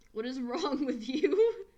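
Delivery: baffled and cracking up; laughing disbelief. Real, not acted. Streamer reacting to absurd character names with laughing disbelief.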